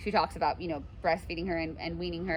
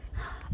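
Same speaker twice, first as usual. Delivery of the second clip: gasp; 8 dB quieter than usual. Acted. Nurse reacts to Paris news.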